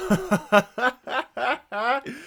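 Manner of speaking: bright burst of laughter; laughing. Real, not acted. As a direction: laugh into the intro. Opening laugh before show.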